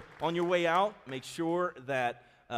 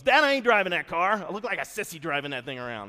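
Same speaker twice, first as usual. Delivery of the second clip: put-on high, loud whiny protest from the kid; whiny teenage son; 3 semitones higher, 9 dB louder than usual. Son refusing his mom's bug, loud whiny protest. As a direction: play the sulky son who won't drive the bug. Son's retold protest to dad.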